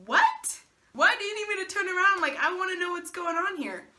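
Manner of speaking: high, loud disbelieving What; retelling the shock of being told to turn around; shocked high outburst. Retold or imitated. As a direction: voice jumps up in shocked protest. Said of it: "I'm like".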